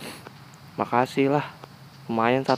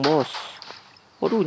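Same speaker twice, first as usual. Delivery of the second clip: ordinary talk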